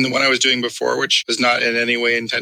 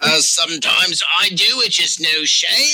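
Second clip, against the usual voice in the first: high pirate aside through laughter; horny pirate; 4 semitones higher, slower than usual. Acted. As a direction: confess it as a lewd, hammy pirate. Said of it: "horny pirate".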